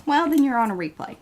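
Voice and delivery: ordinary talk, nothing marked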